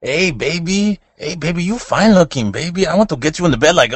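high put-on Mexican-accented growl; a sleazy Mexican Chewbacca hitting on a woman; hammed-up high comic pickup voice. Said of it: "I could do it".

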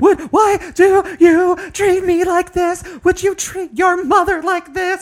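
slow, high, loud wailing shout; imitated screaming. Podcaster acting out the unhappy woman in the alley, screaming. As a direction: wail each word out like an ugly-crying woman. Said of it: "She was not happy. She goes".

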